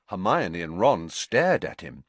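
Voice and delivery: plain reading voice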